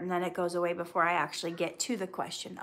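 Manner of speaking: normal talking voice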